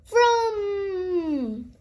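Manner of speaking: engine noise, rising then falling; vroom. A narrator's reading. Narrator makes the toy plane zoom: drawn-out engine vroom.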